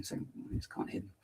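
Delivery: tiny whisper; whispered joke. Pretend. Streamer jokes about a quiet little voice, whispering. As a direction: whisper it like you can't be heard.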